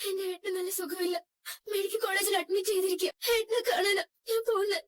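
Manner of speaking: high, tearful crying voice; words breaking into tears. Acted. Wife telling the driver about her sick brother, crying. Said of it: "എന്തിനാ കരയുന്നേ?".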